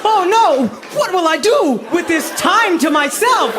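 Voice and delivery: sarcastic cry of fake dread; mock panic. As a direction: ham up fake alarm. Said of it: "sarcastic quote".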